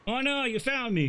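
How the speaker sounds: high-pitched, playful mock dismay; playful child character. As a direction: play a kid caught hiding. Found child in hide and seek.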